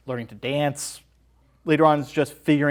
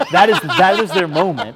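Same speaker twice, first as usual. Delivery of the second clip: laughing excitement; 6 semitones higher, 10 dB louder than usual. Real, not acted.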